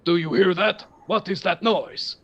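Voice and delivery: a guard hearing a noise, wary; suspicious giant voice. Acted. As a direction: alert giant, lightly voiced. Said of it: "Frost Giant says".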